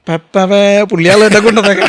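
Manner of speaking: loud call to a crew member; retold call. Someone called out to bring him.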